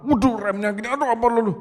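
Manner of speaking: high-pitched flustered fretting; mock alarm at the wheel; acted panic. Driver frets over failing brakes, panicked.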